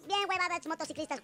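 ordinary talk, nothing marked

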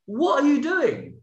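high, loud, slow what are you doing; bewildered. Retold or imitated. Storyteller pinned to the floor, asking the mugger in disbelief. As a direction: stretch it out in stunned disbelief. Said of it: "and I was just like".

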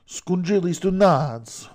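put-on loud, slow, high Italian-New York accent. Host announcing the Italian Squid Game, hammed-up New York accent. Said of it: "I'm doing the New York accent".

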